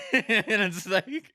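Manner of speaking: high, loud laughter, slowing right down; laughing. Real, not acted. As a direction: laugh it out, dragging the words. Laughing at own drunk happiness.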